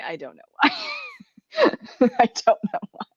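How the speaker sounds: ordinary talk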